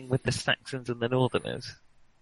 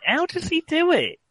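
high amazed question; 13 semitones higher than usual. Real, not acted. Marvelling at another player's dance.

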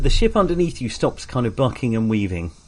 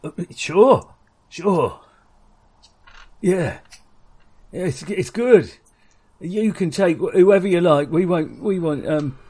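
nervous raider voice; 5 semitones higher than usual. Acted.

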